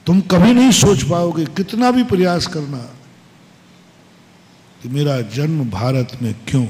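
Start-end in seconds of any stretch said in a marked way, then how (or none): none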